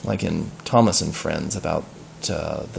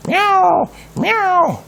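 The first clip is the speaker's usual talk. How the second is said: high, distant cat cries; chorus of meows; 4 dB louder than usual. Acted. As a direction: meow like a hunting pack of cats. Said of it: "a chorus of".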